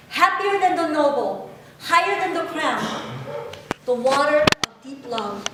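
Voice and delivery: proud, sweeping poem reading; poem recitation. Acted. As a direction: recite it like a heartfelt ode. Recites father's poem aloud.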